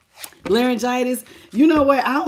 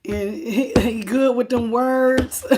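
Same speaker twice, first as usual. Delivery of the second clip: normal talking voice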